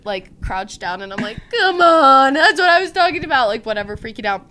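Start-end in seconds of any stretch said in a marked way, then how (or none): 1.6-3.5 high, loud frustrated cry; exasperated outburst. Retold or imitated. Upset with friends who doubted her, loud exasperated cry. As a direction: let the frustration burst out. Freaking out on roadside.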